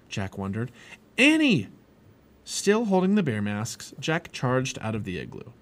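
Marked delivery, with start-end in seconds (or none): 1.2-1.7 high, loud cry of alarm; alarmed call. A narrator's reading. Boy fearing dogs are attacking his companion, alarmed call. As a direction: call out urgently to someone in danger.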